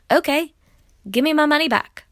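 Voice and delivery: upset customer demanding a refund; angry demand. Real, not acted.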